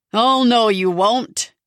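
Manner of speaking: loud panto heckle, oh no you won't; panto shout back. Acted. Larry answering the comedian panto-style, loud. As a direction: yell it back like a panto crowd.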